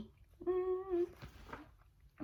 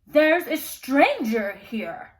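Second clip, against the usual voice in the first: loud, slow boom; 3 semitones higher, 9 dB louder, slower than usual. Acted. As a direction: boom the alarm across the hall. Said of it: "a loud voice boomed".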